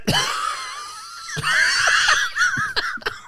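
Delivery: long, high cackling laugh; helpless laughter. Real, not acted. Cracking up at co-host's remark, helpless laughter. Friend cracks up at Brett joke.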